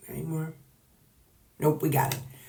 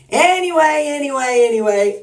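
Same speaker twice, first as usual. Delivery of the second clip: singsong anyway; 8 semitones higher, 6 dB louder than usual. Real, not acted. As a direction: sing the word out high.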